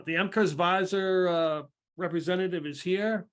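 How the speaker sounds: loud, high herald's announcement; formal announcement. Acted. Herald announces the representative to those waiting, formally. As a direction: announce an official arrival to the room. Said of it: "like, a formal announcement".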